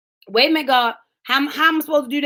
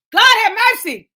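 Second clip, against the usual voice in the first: higher, louder cry of joy; overcome exclamation; 6 semitones higher, 4 dB louder than usual. Real, not acted. Preacher carried away, joyful outcry. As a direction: burst out with praise.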